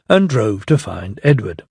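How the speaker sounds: ordinary delivery, nothing marked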